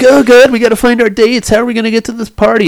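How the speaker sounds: high, lightly mocking girls' voice; mock girlish worry, high-pitched; imitated fretting girls. Voicing the girls fretting about dates.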